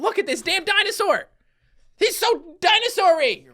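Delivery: high-pitched, giddy admiration; excited gushing. Real, not acted. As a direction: gush over it at the top of your range. Gushing over the dinosaur.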